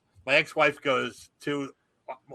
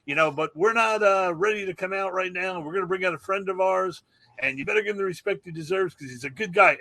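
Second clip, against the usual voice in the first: much higher, showman's welcome to the crowd; stage host shtick; 6 semitones higher than usual. Retold or imitated. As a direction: work the room like a rock star warming up Philly. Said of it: "He would do, like, shtick".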